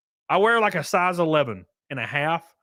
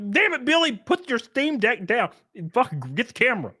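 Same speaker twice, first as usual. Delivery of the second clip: much higher mock scolding; mock exasperation; 7 semitones higher than usual. Streamer acting out yelling at Billy, high mock anger. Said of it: "And I'm like".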